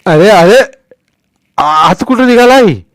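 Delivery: put-on loud, high snapping old man; old man snaps. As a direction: bark at the boys to stop. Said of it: "म्हातारा खेकसला".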